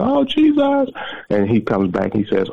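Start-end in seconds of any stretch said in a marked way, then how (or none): 0.0-0.9 very high imitated background scream; mock ecstatic shriek; retold hollering. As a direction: act out a woman hollering in praise. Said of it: "screaming and hollering".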